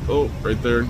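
ordinary delivery

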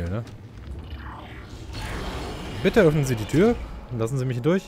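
ordinary talk

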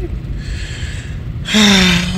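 long, drawn-out exhale; long sigh. Real, not acted. Speaker winding down after a rant, long sigh. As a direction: let out a long breath.

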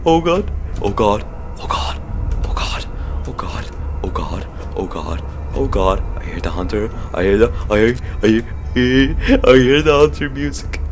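soft, low frightened murmur; hiding, barely breathing the words; scared hush. Real, not acted. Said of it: "I'm legit scared".

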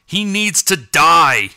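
growled. A narrator's reading. Bigham demanding the kill, growling in rage. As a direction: drag out the threat like a growling cat. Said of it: "growled Bigham".

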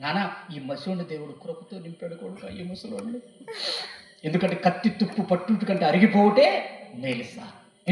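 a hint of a fond elderly pastor, slow in the last line; lightly voiced old pastor. The old pastor telling the preacher he is still eager to work, lightly voiced. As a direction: speak as an old mentor to a son.

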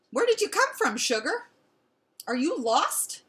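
high, loud cooing questions; kind lady. A narrator's reading. Lady asking a lost dog, high cooing.

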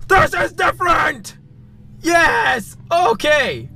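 high excited yelp at the screen; excited shout. Real, not acted. Gamer shouting at a strange new screen, high and excited. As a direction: burst out in surprised delight.

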